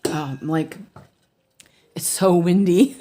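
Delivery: ordinary delivery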